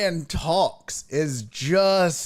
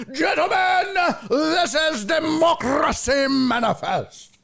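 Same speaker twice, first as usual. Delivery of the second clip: high, theatrical mock orator with rolled Rs; hammed-up, booming impression of a pompous arrestee; Jack Carlson; 13 semitones higher than usual. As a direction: proclaim it grandly, drawn out, rolling the Rs. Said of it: "I'm doing this accent".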